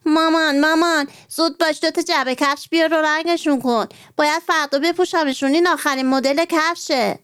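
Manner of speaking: put-on a child hurrying her mother; mild excited shout, rushing; excited call. A narrator's reading. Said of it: "داد زد".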